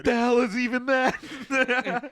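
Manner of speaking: wysoki, głośny, przeciągnięty okrzyk ze śmiechem; mock outraged voice. Mock dad reacting.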